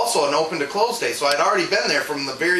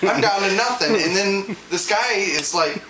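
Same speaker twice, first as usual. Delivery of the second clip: usual speaking voice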